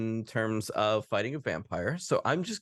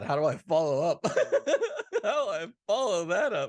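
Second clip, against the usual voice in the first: after laughing hard; 7 semitones higher than usual. Real, not acted. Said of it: "my mouth hurts from laughing".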